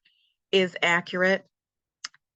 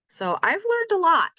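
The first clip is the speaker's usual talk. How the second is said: much higher, sing-song proud declaration; 12 semitones higher, 3 dB louder than usual. Real, not acted. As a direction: announce it up high like a little triumph. Proud summary of learning.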